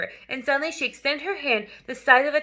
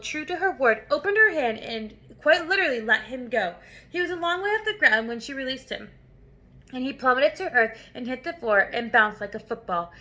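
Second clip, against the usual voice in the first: plain reading voice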